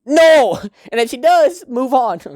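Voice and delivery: loud high "No!" breaking into laughter; exasperated outburst. Real, not acted. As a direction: protest in a high, laughing cry. Emphatic rejection, laughing.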